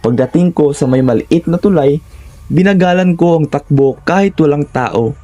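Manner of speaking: ordinary delivery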